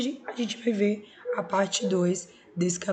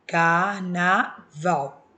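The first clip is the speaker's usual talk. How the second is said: carnaval stretched out very slowly, syllable by syllable; 5 dB louder, much slower than usual. Shown as a demonstration. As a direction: separate car-na-val slowly for the class. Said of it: "Quando eu separo a sílaba".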